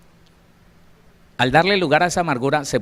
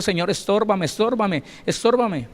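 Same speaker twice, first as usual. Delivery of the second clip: pleading prayer; 6 semitones higher than usual. Retold or imitated. Woman retells her pleading prayer.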